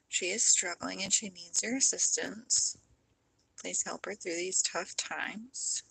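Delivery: soft, hushed praying voice; spoken prayer. Real, not acted. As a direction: pray quietly and earnestly for someone struggling. Prays aloud for a viewer.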